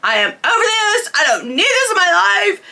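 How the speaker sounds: high, crying and fed up; tearful outburst. Retold or imitated. Retelling to listeners her crying words to her friend at the window. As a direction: voice cracking with tears, done with it all. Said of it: "I was just like".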